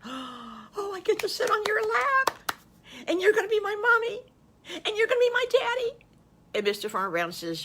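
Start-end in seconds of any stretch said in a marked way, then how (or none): 0.0-4.2 put-on much higher, squealing little child voice; a thrilled little boy who can't believe it; excited little wolf. 4.8-6.0 put-on much higher, squealing little child voice; a thrilled little boy who can't believe it; excited little wolf.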